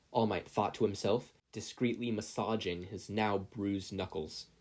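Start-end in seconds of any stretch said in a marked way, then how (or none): none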